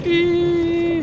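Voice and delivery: high thrilled squeal down the drop; excited squeal. Real, not acted. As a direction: squeal like you're on a coaster. Riding a steep roller coaster.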